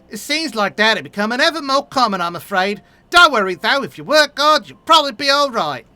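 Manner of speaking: a hearty, upbeat townsfolk voice; hearty encouraging NPC. Acted. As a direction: buck the newcomer up.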